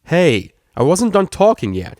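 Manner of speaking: loud, slow angry protest; angry stallion. Acted. Stallion shoved out of office, angry and loud. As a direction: shout it back at the door. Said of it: "he said angrily".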